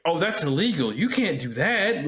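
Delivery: 2 high, indignant mock protest; 3 protest like the rules were broken; 1 mock outrage. 4 retelling a horror scene to co-host, mock indignation. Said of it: "where it's like".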